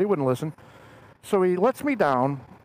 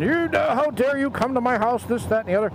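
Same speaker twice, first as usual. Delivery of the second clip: indignant retold rant, pitched up; mock angry outburst; 7 semitones higher than usual. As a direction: play the outraged homeowner, voice raised in pitch. Retold angry man confronting visitors.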